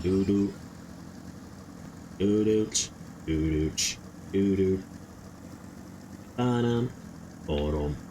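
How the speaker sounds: deep "doo-doo" and "ba-dum" spy beats; low mock suspense beats; low mouthed sneaking beat. Real, not acted. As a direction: drop low and beatbox the tension. Mouth sound effects while sneaking.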